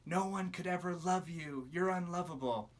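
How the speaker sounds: a lightly voiced sneering accuser; accusing voice. Shown as a demonstration. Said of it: "if you hear the voice saying".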